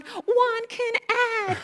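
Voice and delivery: high, sing-song cheer; kids cheering. Retold or imitated. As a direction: chant it like amazed classmates. Nearby kids cheering him.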